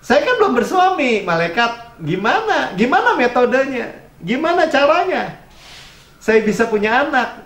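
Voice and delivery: Mary asks the angel how it can be, high and wondering; Mary's wondering question. Retold or imitated. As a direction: ask the angel in honest amazement. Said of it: "Kalau Maria lebih kepada gini".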